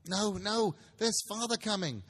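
little ones shouting with glee; children crying out. Acted. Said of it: "cried the two young Cratchits".

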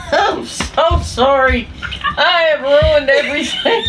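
high, slow words through laughter; squeaky helpless laughing wail; laughing apology. Real, not acted. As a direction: confess while cracking up. Helpless laughter over the mistake.

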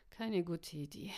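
plain reading voice

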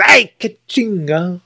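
slow drawn-out ouch; pained cry. Retold or imitated. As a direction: drag it out like a hurt finger. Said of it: "I hit my fingers".

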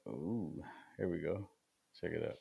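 normal talking voice

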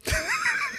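high burst of laughter. Real, not acted. Laughs at film flop joke.

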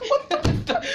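very high laughing squeal; laughing at the baby's fall; laughing shock. Retold or imitated. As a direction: shriek it while laughing. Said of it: "I heard a boom".

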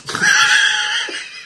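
a high shrieking laugh breaks out; burst of high laughter. Real, not acted. Co-host cracking up at a quote, high laughing shriek.

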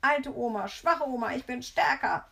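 high, loud bratty chant; jubilant taunting. A narrator's reading. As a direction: crow over grandma in a child's sing-song. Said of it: "jubelt er in den hei-, höchsten Tönen".

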